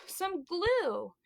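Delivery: slow, deliberate show-and-tell; slow teacher reveal. Shown as a demonstration. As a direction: draw it out for the kids.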